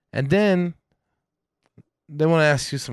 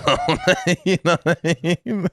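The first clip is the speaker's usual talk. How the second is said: laughing; 6 semitones higher than usual. Real, not acted. Laughing over awkward bathroom text.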